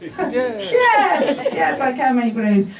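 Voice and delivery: high, bursting yes, yes; overeager yes. Retold or imitated. To the caller booking balloons, a high eager yes. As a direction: blurt out the yes before thinking. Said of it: "You know what I said?".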